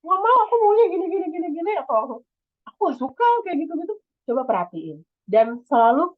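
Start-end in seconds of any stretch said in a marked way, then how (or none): 0.0-1.7 high, fast insistent demand; ngegas, pushy. Shown as a demonstration. Speaker showing the wrong, aggressive way to talk, high and fast. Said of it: "jangan langsung ngegas gitu". 2.6-3.9 pushy complaint. Shown as a demonstration. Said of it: "jangan langsung ngegas gitu".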